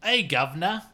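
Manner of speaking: high, loud mock British accent, slow; a hammed-up British bloke accent; angry British man. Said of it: "a British man who's angry about breakfast".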